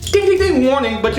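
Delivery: usual speaking voice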